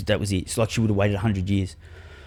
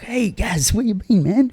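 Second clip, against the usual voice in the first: much higher, jester-like cheeky voice; cheeky mate; 12 semitones higher, slower than usual. Retold or imitated. Dead mate greets Gaz, high and cheeky. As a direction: play the grinning joker friend.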